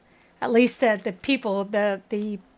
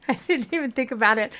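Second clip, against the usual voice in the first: ordinary talk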